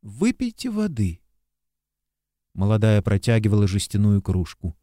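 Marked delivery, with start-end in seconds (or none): 0.0-1.2 put-on higher, gentle young woman; young woman's voice. A narrator's reading. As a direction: offer the water softly. Young woman offers water.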